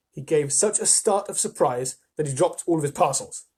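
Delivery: usual speaking voice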